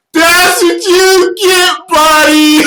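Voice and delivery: very high, loud, slow gloating shout; Ashley Miller voice. Retold or imitated. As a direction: gloat at the crashed driver like a Canadian skater girl. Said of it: "exactly what Ashley Miller talks like".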